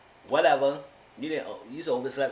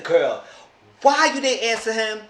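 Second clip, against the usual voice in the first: exasperated yell; 9 semitones higher, 9 dB louder than usual. Retold or imitated. Scolding Sarah over the call.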